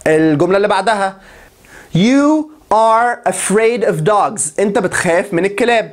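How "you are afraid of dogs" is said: You are afraid of dogs stretched out slowly; slow model sentence. Shown as a demonstration. Teacher models the sentence slowly for students. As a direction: read it out slowly for the class. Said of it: "model sentence slow".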